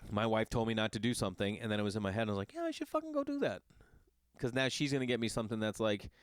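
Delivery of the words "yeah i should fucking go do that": defiant mock thought. Mocking own defiant thought.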